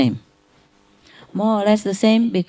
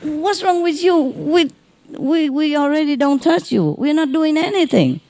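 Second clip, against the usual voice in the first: much higher, mock exasperated nurse voice; impatient hospital staff; 9 semitones higher than usual. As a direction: play the annoyed nurse scolding her patient. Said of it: "and they say".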